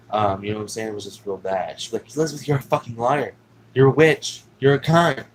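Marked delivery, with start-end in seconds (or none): 2.2-5.2 loud mock tirade at a child; retold angry yelling. Aunt screaming insults at little Elizabeth, mock yelled. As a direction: yell insults like the abusive aunt.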